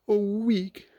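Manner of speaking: a touch of gloom in high Pooh voice; gloomy. A narrator's reading. Stuck Pooh hearing it takes a week, gloomy. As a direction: sigh it out glumly. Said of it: "said Pooh gloomily".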